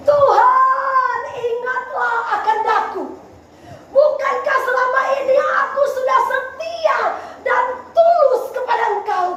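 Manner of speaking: high, loud, pleading wail; Hizkia's tearful prayer. Acted. Preacher acting out Hizkia praying from his sickbed, anguished plea. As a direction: cry out to God in acted anguish. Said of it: "ini doa Hizkia".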